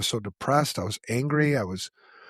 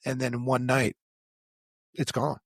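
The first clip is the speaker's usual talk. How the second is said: normal talking voice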